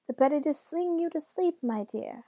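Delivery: high put-on granny lilt; imitation doting granny; grandmother voice. A narrator's reading. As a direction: croon it high, easing down at the end. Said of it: "in his best grandmother voice".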